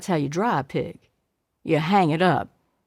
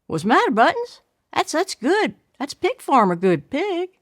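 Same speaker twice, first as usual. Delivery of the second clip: cheerful coaxing; 7 semitones higher than usual. Acted. Daddy coaxing girl to eat.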